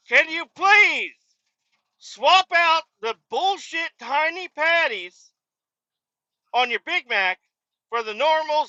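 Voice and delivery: hammed-up rage at the clueless cashier; dad screaming at the McDonald's worker; furious dad. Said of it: "he's like".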